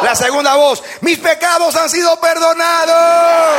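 high shouted declarations with held endings; proclamación a gritos. Real, not acted. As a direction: lead the crowd, stretching each last word. Leads congregation in shouted declarations. (proclamación aguda y sostenida a gritos)

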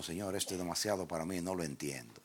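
very soft, low murmured prayer; subdued bewilderment. Retold or imitated. Preacher reenacting being left confused before God, hushed and low. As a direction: confess it to God almost under the voice. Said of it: "me quedé así, como diciendo".

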